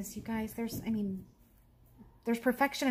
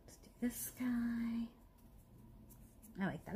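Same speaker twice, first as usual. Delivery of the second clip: whispering; 4 semitones higher, 8 dB quieter than usual. Real, not acted. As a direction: whisper to yourself. Whispering to herself while crafting.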